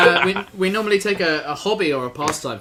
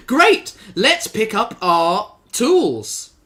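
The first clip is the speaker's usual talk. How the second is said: excited gardener; 6 semitones higher, 5 dB louder, much slower than usual. Acted. Improv scene, hearty gardener character.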